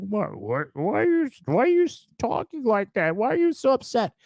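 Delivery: mock the neighbours in a squeaky whine; whiny mock question.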